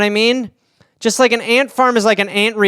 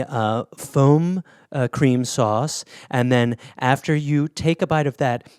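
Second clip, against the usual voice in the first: lower, softer, slow fancy presenter voice; pretentious chef; 7 semitones lower, 4 dB quieter than usual. Acted. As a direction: present the dish with snooty care. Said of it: "someone's just like".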